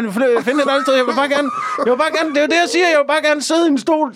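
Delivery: high-pitched, exasperated complaint; irritated demon. Acted. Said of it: "som dæmon, øh, oftest bare gerne vil have noget fred og ro".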